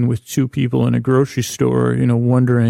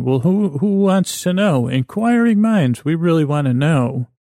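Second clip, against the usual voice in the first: playful put-on advert voice, pitched up; mock ad voice; 5 semitones higher than usual. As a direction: ham up a TV commercial's question and answer. Acting out imagined tabloid TV commercial.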